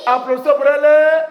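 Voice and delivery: high, loud call to clap; rally the class to cheer her; calling for applause. Real, not acted. Teacher calls for applause.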